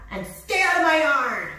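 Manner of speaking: high, loud grumpy order; yelling back. A narrator's reading. Cactus orders the jackrabbit away, yelling back. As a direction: yell the intruder off your land. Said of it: "Hank yelled back".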